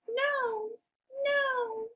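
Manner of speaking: very high, squeaky little pig; high-pitched piggy voice. Acted. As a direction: squeal a refusal as a tiny pig. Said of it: "the two little pigs both say".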